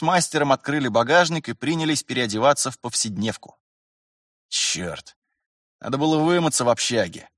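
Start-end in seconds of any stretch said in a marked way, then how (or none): 4.5-7.3 vexed mutter. A narrator's reading. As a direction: grumble with mild annoyance. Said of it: "пробормотал Терентьев с досадой". (с досадой, себе под нос)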